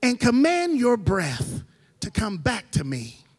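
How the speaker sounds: slow, drawn-out voice of God commanding; God's slow command. Retold or imitated. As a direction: stretch each word like a divine order. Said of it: "He says".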